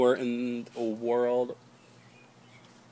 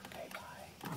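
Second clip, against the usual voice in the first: whispering; 20 dB quieter than usual. Real, not acted. Whispered goodbye, baby sleeping.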